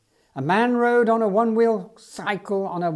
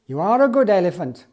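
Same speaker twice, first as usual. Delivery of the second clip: a bright, louder cry of praise; cried out; 2 semitones higher, 7 dB louder than usual. Acted. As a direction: praise the elephant with a happy cry. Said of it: "cried Banny".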